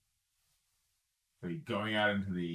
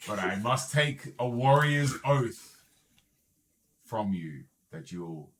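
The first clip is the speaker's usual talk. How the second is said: frost giant; 6 dB louder than usual. Acted. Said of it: "He says".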